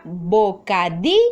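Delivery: bocadillo split, with the force on di; stress on DI, syllable by syllable. Shown as a demonstration. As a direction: say bocadillo as bo, ca, DI, llo. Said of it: "¿Dónde hago mayor fuerza de voz?". (bocadillo por sílabas, con fuerza en di)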